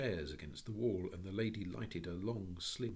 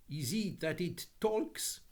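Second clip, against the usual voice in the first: put-on high, French-accented lady's welcome; 10 semitones higher, 5 dB louder, slower than usual. A narrator's reading. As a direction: greet them in a lilting French accent. French lady asks.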